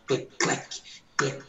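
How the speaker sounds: soft breathy clec clec of the eye machine; machine clicking. Retold or imitated. Imitating the eye machine for the church, a soft clicking. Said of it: "assim, ó".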